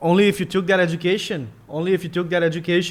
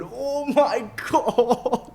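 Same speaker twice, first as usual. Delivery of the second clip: helpless laughter, slowed; can't stop laughing; 9 semitones higher, 4 dB quieter, much slower than usual. Real, not acted. Laughing hard.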